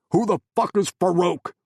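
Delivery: loud, high furious snap; angry shout. Acted. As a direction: spit out the curse. Angry interrogation.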